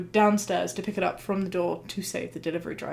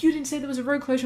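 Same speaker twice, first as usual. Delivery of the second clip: mock shout; 5 semitones higher, faster than usual. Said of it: "they shouted out".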